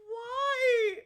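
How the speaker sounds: gasp, then a high wailed question; tearful disbelief. Real, not acted. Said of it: "I'm gonna cry".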